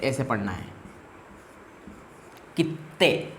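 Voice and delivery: कित्ते said with a held double t, kit-te; doubled t in kitte. Shown as a demonstration. Teacher modelling kitte with a held double t. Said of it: "तो इसको फिर ऐसे".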